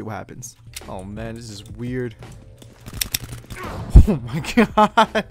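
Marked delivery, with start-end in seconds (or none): 2.2-4.0 breathy laugh rising into a high squeal; startled laugh. Real, not acted. Player reacting to a weird aim setting, startled laugh. 4.1-5.2 high, loud, drawn-out disbelief; stunned exclamation. Real, not acted. Player stunned by the weird setting, amazed cry. Stunned by what just happened.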